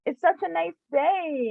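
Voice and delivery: high, lilting cheerful lady imitation; joyful. Storyteller imitates lady chatting with her family, joyful. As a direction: chirp it high, full of joy.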